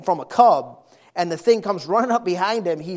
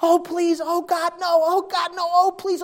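high, pleading mock wail; imitated crying scream; 10 semitones higher than usual. Woman crying out to God in desperation, imitated wailing scream. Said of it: "screaming the most was crying".

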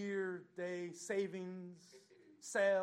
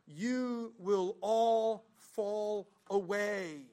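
Jesus' warning; 6 semitones higher, 9 dB louder, much slower than usual. Acted. Jesus quoted solemnly.

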